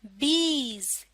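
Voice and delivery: बीज stretched out very slowly; slow reading demo; slow blending. Shown as a demonstration. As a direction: draw बीज out sound by sound. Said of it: "आप भी पढ़िए।".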